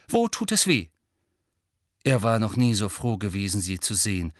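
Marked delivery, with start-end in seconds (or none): 0.1-0.9 a hint of an urgent, higher voice; raised, pressing question; concerned professor. Professor questioning the sick boy urgently.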